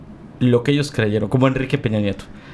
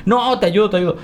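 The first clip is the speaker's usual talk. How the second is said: insisting; 7 semitones higher than usual. Retold or imitated. Voicing Peter insisting.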